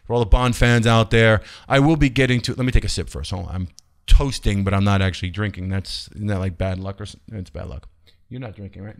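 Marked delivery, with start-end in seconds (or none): 5.7-9.0 dropped to a near-murmur, much softer; muttering the superstition to himself, much softer; quiet aside. Real, not acted. To himself while toasting Connery, a much softer aside.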